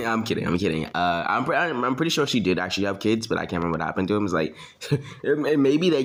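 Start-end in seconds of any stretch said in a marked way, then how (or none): none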